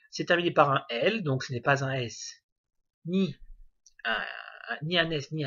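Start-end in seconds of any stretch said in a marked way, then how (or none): none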